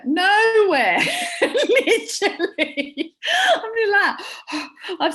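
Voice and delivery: high, loud laughing, slow. Real, not acted. Joking about a bad skirt, laughing. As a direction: laugh the words out slowly.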